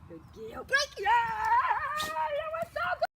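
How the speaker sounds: high, loud, gushing praise; excited release. Real, not acted. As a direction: celebrate the dog's success. Releasing dog, celebrating.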